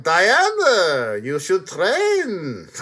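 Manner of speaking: slow, drawn-out mock Israeli accent; Robin Wright. Warrior woman urging Diana to train, mock Israeli accent. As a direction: stretch each word in a hammy vague accent. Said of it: "even Robin Wright is doing".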